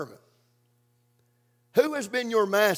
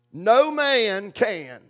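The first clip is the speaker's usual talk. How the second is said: slow scripture stress; much slower than usual. Shown as a demonstration.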